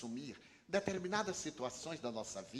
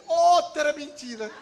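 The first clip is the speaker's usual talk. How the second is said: put-on much higher, louder, slow child's accusing voice; cheeky child; 11 semitones higher, 13 dB louder, slower than usual. Little Julinho to uncle Divaldo, high cheeky child accusation. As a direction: play the boy catching an adult in a lie.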